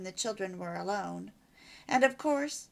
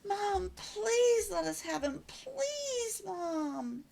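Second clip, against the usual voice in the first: put-on much higher, slow pleading child's voice; begging child; 11 semitones higher, much slower than usual. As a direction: plead like a kid who wants a puppy.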